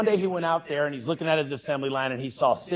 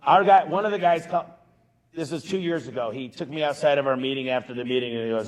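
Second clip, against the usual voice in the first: ordinary delivery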